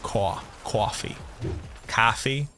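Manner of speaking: slow, round "caw-fee" New York coffee. Shown as a demonstration. The speaker mocking New Yorkers' coffee, slow and rounded. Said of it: "an O shape to their mouth".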